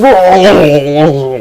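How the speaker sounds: loud high cry of pain. Acted. Fairy pierced by Edge's spike armor, loud high yelp.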